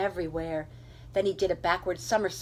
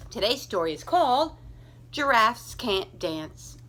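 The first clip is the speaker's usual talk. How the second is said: title announcement; 3 semitones higher than usual. A narrator's reading.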